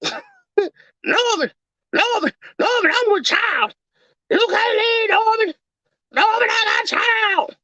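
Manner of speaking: high falsetto woman's wail; hammed-up pregnant woman. Abandoned pregnant woman pleading with Norman, in a high falsetto wail. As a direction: beg Norman not to leave you. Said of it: "you sound like you are a sixty-year-old woman".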